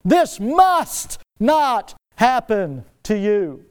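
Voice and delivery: very slow, drawn-out plea; slow, pleading whisper to the master; slow earnest plea. Retold or imitated. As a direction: linger on each word, begging. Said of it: "pulls Jesus aside and says".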